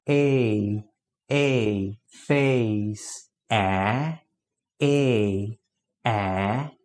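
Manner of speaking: "A" as the long "ei", drawn out. Shown as a demonstration. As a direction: stretch the long vowel. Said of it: "a vogal A é longa". ("A" longo, como "ei")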